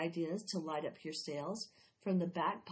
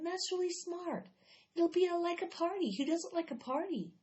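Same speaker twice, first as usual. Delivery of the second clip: put-on higher, bright kid voice for Megan; excited girl; 9 semitones higher than usual. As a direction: play the thrilled young friend, pitched up.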